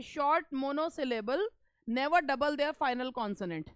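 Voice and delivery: very slow, measured quote of the rule; quoting the rule slowly. Shown as a demonstration. Teacher quoting the question's rule to students, slow and spaced. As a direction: dictate the rule word by word. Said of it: "ये question ये था आपका".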